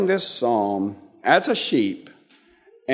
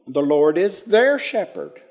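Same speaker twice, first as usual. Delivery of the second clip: their pitched up and leaned on; stress on their; 2 semitones higher than usual. Shown as a demonstration. Teacher offers David another wording, stress on their. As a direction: climb to their, then fall. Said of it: "He could say".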